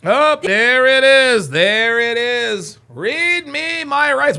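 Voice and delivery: slow mocking exclamation. Real, not acted. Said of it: "streamer mocking catchphrase".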